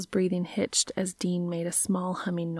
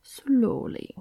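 put-on French accent; 7 semitones higher, 5 dB louder than usual. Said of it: "remembering the bit".

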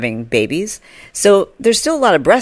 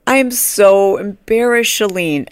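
embarrassed fan; 5 semitones higher, slower than usual. Retold or imitated. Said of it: "she's like".

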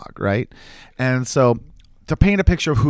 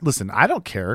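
ordinary delivery, nothing marked

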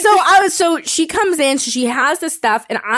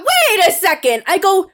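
indignant confrontation; 7 semitones higher than usual. Retold or imitated. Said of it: "I went".

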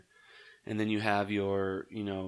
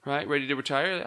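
usual speaking voice

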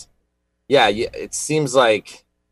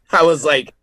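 ordinary talk, nothing marked

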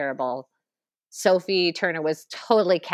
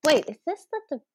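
quick, higher-pitched, puzzled question; retold puzzled reaction; 8 semitones higher, faster than usual. Viewer recalling confusion during the film, retold puzzlement. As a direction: replay your confusion, pitched up and hurried. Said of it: "when I was like".

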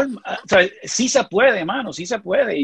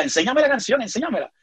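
higher-pitched pleading, softening at the end; lightly imitated motherly nagging, pitched up; imitating insistent mother; 6 semitones higher than usual. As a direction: mimic a mother eagerly asking. Retells mother pleading to hear song.

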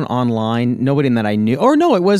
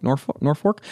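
ordinary delivery

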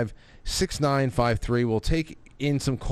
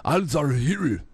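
Al Zawahiri in a mock Japanese accent, pitched higher; higher, jokey Japanese accent on "Al Zawahiri"; 5 semitones higher than usual. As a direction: say "Al Zawahiri" as a Japanese speaker might, playfully. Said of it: "that's the Japanese saying it".

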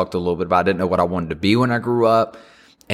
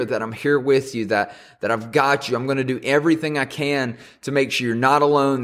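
usual speaking voice

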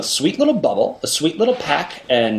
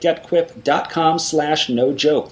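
upbeat ad read with promo code; holiday sale ad; 4 semitones higher than usual. Acted. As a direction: sell the holiday deal.

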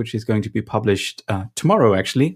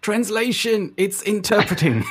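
much higher, slow, put-on fussy voice with a laugh; mock pedantic interpreter; 11 semitones higher, slower than usual. Host mocks interpreters' pet peeve in a high fussy voice. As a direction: parody a nitpicking interpreter correcting someone. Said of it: "the internal pet peeve of interpreters".

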